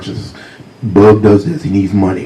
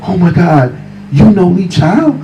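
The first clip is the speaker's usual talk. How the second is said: amazed friend; 9 semitones higher than usual. Retold or imitated. Friends amazed at Lee Child.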